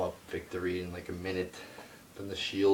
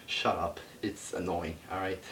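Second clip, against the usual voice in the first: ordinary talk, nothing marked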